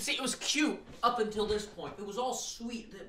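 high-pitched plaintive complaint; dismayed whine. Real, not acted. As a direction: lament it in a high voice. Upset reader laments the turn.

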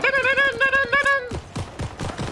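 high hummed dun dun tune, slowing at the end; hummed theme tune. Retold or imitated. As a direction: hum the entrance theme up high. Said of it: "This little noise, like".

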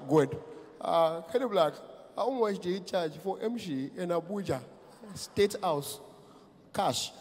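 soft-voiced presidential enquiry; Muhammadu Buhari. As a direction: ask quietly in short, hesitant pieces. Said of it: "our president has got the sexiest voice".

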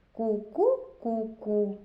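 a two-note cuckoo imitation; cuckoo call. Teacher giving children a riddle clue, cuckoo call. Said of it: "та у лісі в холодку все кує".

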